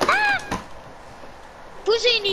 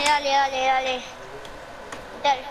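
normal talking voice

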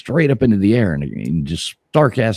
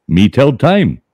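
higher, louder stilted answer; mock Indian voice; 7 semitones higher, 7 dB louder, much slower than usual. Joke teller voicing the Indian, stiff and loud. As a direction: say it stiff and proud.

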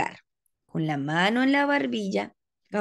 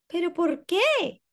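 high, slow plaintive question; pleading girl; 8 semitones higher than usual. Acted. Ada asking her angry parents, high and slow. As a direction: beg to understand. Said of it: "dijo Ada".

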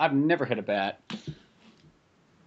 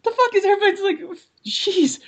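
much higher, incredulous squeak; baffled outrage. Retold or imitated. Driver confused by dirty looks at the lake, high incredulous squeak. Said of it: "and I'm like".

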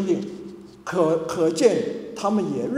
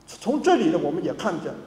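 ordinary talk, nothing marked

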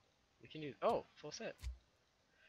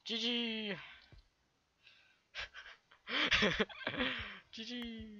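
excited; 13 semitones higher, 7 dB louder, much slower than usual. Real, not acted. Celebrating a win in game.

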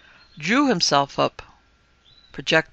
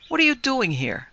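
sharp demand; 9 semitones higher than usual. Acted. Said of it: "I could see him wince".

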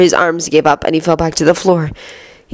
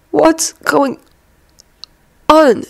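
higher, halting voice of an injured man; slow, pained speech; 10 semitones higher, much slower than usual. A narrator's reading. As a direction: break the question with long pauses. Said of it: "the professor spoke slowly".

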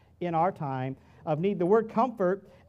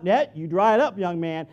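stern order; 7 dB louder than usual. Retold or imitated. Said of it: "I'm like".

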